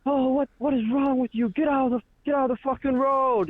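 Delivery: high-pitched mock yelling, no real volume; imitated angry shouting. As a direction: act out an irate driver yelling. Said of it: "They're shouting at us".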